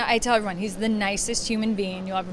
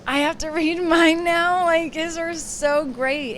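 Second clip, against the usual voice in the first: higher, louder, slow dismay; mock panic; 7 semitones higher, 4 dB louder, slower than usual. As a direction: dread having to follow his vows. Panicking after husband's great vows.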